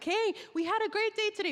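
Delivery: high-pitched, upbeat reassurance; ecstatic greeting. Retold or imitated. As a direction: call it out brightly to reassure an anxious mum. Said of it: "tried my hardest to be ecstatic and smiling".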